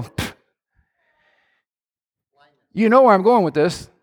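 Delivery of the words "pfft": dismissive pfft. Retold or imitated. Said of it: "And we go".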